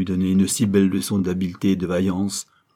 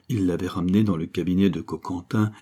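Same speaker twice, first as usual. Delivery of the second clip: plain reading voice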